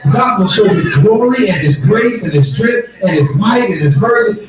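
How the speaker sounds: high, shouting preacher build; preaching at full force. Acted. Preacher shouting to the congregation that the answer is coming. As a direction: whoop it up to the congregation.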